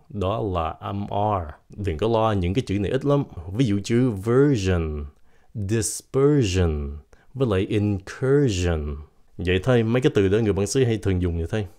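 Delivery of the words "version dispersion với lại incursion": slow list, "sion" with voiced zh; "jin" endings. Shown as a demonstration. Teacher gives R examples, "sion" as "jin". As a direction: say "version" slowly, ending in "jin". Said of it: "nó là jin luôn".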